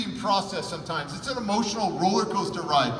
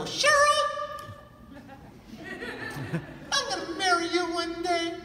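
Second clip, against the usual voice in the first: put-on much higher kid voice with a laugh; little boy's declaration; 10 semitones higher than usual. Boy in the car telling Cheryl he'll marry her, high and giddy. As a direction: blurt it out like an excited small boy. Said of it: "I go".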